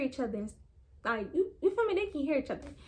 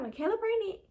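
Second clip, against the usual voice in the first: much higher, incredulous question; shocked disbelief; 9 semitones higher than usual. Retold or imitated. Dad guessing the wrong daughter, much higher and incredulous. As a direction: gasp the name in disbelief. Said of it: "he was like".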